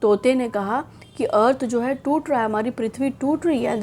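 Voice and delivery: usual speaking voice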